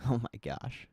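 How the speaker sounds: ordinary delivery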